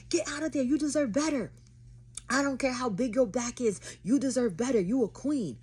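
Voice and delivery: high, loud cheerleading from outraged friends; urgent hype. Retold or imitated. Live viewers urging her to leave the date, loud and high. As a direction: shout it high like fired-up supporters.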